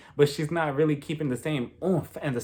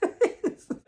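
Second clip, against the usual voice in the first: high laugh. Real, not acted.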